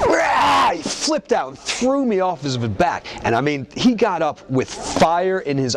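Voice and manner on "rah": loud high angry roar. Retold or imitated. Brock exploding off his back, a loud roar. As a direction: let out a sudden furious bellow.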